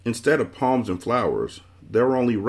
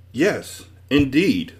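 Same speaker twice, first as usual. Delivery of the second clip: higher, pleased "yes, indeed"; happy reply; 6 semitones higher, 4 dB louder, much slower than usual. A narrator's reading. Man agrees to describe himself, happy. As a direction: answer with happy readiness, voice lifted. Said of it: "the man replied happily".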